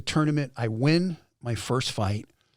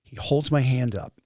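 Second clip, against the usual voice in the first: ordinary delivery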